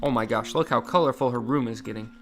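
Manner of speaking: ordinary delivery, nothing marked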